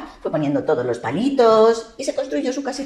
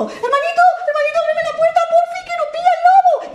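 very high squeaky piglet plea; pleading piglet; 5 dB louder than usual. Acted. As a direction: beg in a tiny piglet voice. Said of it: "y le dijo".

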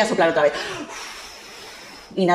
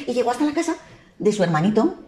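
ordinary delivery, nothing marked

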